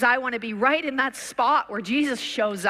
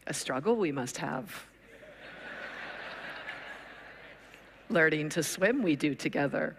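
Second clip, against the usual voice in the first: sage Jedi master, lowered and unhurried; Yoda impression; 8 semitones lower, 7 dB quieter than usual. Preacher plays Yoda to his impatient student.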